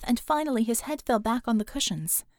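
usual speaking voice

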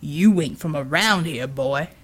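a brute gloating over a beaten woman. Acted. Villain's voice.

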